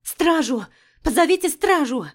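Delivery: loud, high alarmed shout; shouting for the guards. Acted. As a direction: yell out for help. Alarm in the temple.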